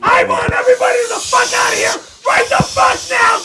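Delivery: furious shout. Real, not acted. Owner explodes at guests.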